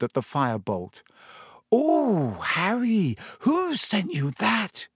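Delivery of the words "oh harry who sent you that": high, drawn-out gasp of wonder; slow and high, as if open-mouthed; awed surprise. Acted. Hermione staring at Harry's new broom, awestruck.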